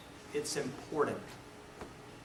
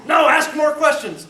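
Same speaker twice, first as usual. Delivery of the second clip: high, loud barked command; furious mentor yelling at rookie; angry order; 13 semitones higher, 16 dB louder than usual. Retold or imitated. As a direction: shout him back to work. Mentor shouting at him.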